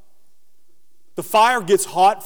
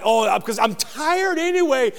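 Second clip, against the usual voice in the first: angry; 7 semitones higher than usual. Retold or imitated. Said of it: "I'm like".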